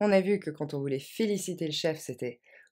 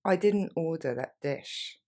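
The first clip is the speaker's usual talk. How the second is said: slower, careful repeat of the phrase; slow model-sentence delivery; 4 dB quieter than usual. Shown as a demonstration. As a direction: spell it out slowly for the learner. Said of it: "Encore une fois".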